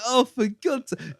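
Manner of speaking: high, fed-up exasperation; exasperated. Retold or imitated. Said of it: "I'm like".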